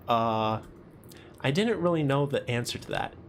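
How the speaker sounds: usual speaking voice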